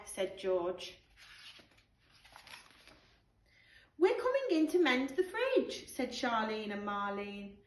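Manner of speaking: put-on lively storybook read-aloud for children; animated picture-book narration with character voices; bedtime story reading. Teacher reads bedtime story aloud.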